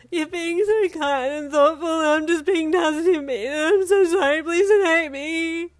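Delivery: high, loud, slow sobbing plea. A narrator's reading. Yin sobbing her apology to Ida, high and loud. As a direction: cry it out, begging through tears. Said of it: "you sobbed".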